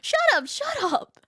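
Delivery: put-on sulky child telling everyone off; petulant shut up. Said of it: "she replied with".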